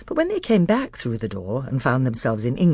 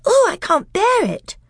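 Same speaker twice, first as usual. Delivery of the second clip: distressed; 5 dB louder than usual. Acted. Lucy frightened.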